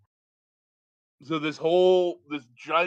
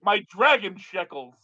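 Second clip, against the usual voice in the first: loud hammed-up Yiddish accent; 3 semitones higher, 5 dB louder than usual.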